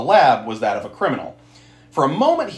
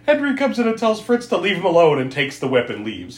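usual speaking voice

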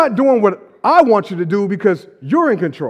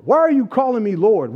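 ordinary talk

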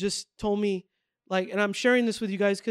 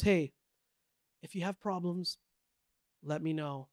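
low quiet offer; 4 semitones lower, 7 dB quieter than usual. Retold or imitated. Retold tough guy offer.

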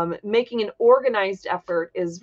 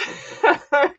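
high giggle burst; a short breathy giggle; stifled giggles; 7 semitones higher than usual. Real, not acted. Host cracks up on air.

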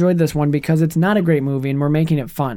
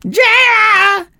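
high, screamed rock-singer yell; imitation rock scream, very high; 4 dB louder than usual. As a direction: wail it like a rock frontman. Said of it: "'Cause he shouts".

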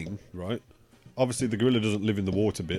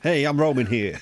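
mock New York American accent; comic American street-guy accent; 2 semitones higher than usual. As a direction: put on an affronted American accent. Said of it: "it's Americans all the same".

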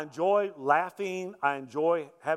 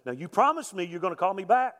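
louder, higher, pressing like a pretend official; mock stern demand; 5 semitones higher, 5 dB louder than usual. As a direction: press them hard as a prank caller posing as a taxman. Acting stern tax agent on prank.

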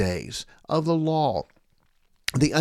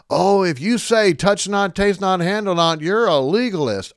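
hammed-up mock of opponents, louder and higher; mocking quote; 5 semitones higher, 7 dB louder than usual. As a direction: sneer out their slogan in a raised voice.

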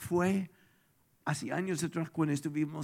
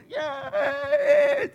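high, loud cheer; victory whoop; 10 dB louder than usual. Acted. Israelites cheering as Jericho's walls fall, loud whoop.

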